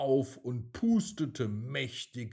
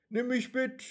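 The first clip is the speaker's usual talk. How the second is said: much higher, small pleading voice; eager raindrop; 13 semitones higher, 4 dB louder than usual. A narrator's reading. Raindrop asks the wind to take it along, eager and high. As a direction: ask brightly like an eager child.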